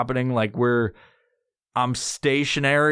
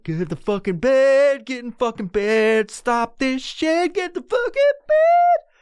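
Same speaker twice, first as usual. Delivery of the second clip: rising, slowing yell to himself; building frantic yell; 9 semitones higher, 3 dB louder than usual. Retold or imitated. Telling himself to get into bed, climbing yell. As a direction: order yourself to bed, louder each time. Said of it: "I was just like".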